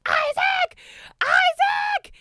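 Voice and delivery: very high, loud name call; screaming. Retold or imitated. Fan screaming at security guard, frantic high yell. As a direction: scream for someone's attention. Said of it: "screaming at our security guard".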